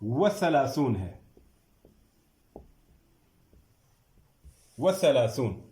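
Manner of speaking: slow, clear new word; drawn-out teacher model word; stressed answer. Shown as a demonstration. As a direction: spell out the new word for students. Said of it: "وہ کیا ہے؟".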